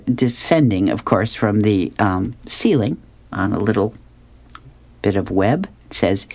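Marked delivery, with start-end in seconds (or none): none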